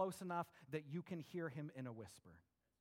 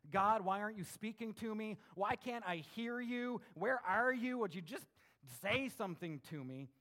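raised, pleading frustration; super frustrated; 4 semitones higher, 5 dB louder than usual. Acted. Frustrated believer demanding that God answer, exasperated. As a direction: cry out higher and louder, easing toward the end. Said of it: "you were super frustrated".

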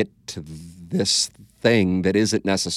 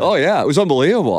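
excited; 8 semitones higher, 7 dB louder, faster than usual. Real, not acted. Excited retelling of courtroom charge.

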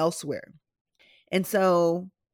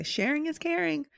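mock saying; 9 semitones higher, 3 dB quieter than usual. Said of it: "I know people say".

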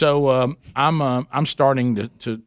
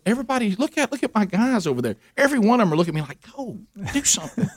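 much higher, eager rallying cry; mock heroic plea; 8 semitones higher, faster than usual. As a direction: play up the leader's excited appeal. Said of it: "And I said".

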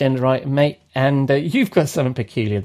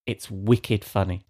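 slow, lower, softer mock Boston accent; imitated Boston accent; 5 semitones lower, 5 dB quieter, slower than usual. Host quoting Jeff's recommendation of the song, imitated Boston accent. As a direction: lightly put on a Boston accent, taking it slowly. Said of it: "in his best Boston accent".

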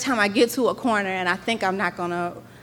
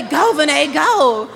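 louder mock cheer, laughing through it; imitating dad cheering; 6 semitones higher, 7 dB louder, slower than usual. Dad cheering his daughter at the race, loud mock cheer. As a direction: cheer her on like a proud dad. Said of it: "And telling me".

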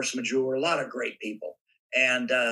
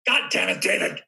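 raised, higher angry yell at the makeup man; gruff yell; 4 semitones higher, 3 dB louder than usual. Retold or imitated. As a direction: snap it at David, louder and higher. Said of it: "yelling at David Miller".

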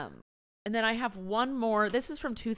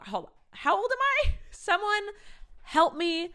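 normal talking voice

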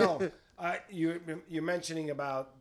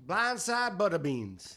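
slow, loud country drawl; country accent; 4 dB louder, slower than usual. Shown as a demonstration. Joining a podcast country-voice challenge, slow country accent. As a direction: stretch it out in a country twang. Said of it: "Everybody do your best country voice".

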